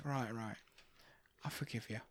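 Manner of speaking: quiet menace. Pretend. Gangster acted quietly before threat.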